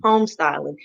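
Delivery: ordinary talk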